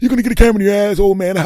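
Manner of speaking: high, loud mimicry of the mocking kid; mocking imitation. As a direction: throw the taunt back in a squeaky mocking voice. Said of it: "You've already mocked me".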